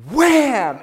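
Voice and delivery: loud sharp "Wham!" for the rip; wax strip rip. Retold or imitated. Daughter rips the wax strip off, loud mock wham. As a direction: slam out the rip sound.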